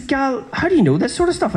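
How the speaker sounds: can't believe what you're hearing; baffled squeaky disbelief at the teammate; incredulous. Retold or imitated. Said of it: "And I says".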